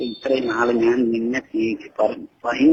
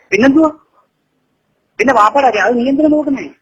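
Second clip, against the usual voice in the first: loud, much higher shouting at his daughter; angry outburst; 10 semitones higher, 6 dB louder than usual. Real, not acted. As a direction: let the anger burst out. Father erupts angrily at daughter. (ദേഷ്യത്തോടെ ഉച്ചത്തിൽ മകളോട്)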